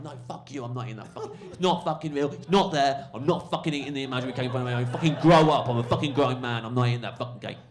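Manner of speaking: retold furious refusal. Heckler refusing imaginary cake from comic, loud mock-furious rant. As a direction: imitate an exasperated heckler losing it. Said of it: "The guy's fucking furious".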